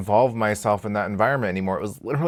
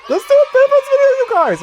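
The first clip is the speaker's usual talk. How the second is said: much higher and louder, fast; squealing excitement; 14 dB louder, faster than usual. Real, not acted. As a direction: burst out with the idea. Sudden excited idea.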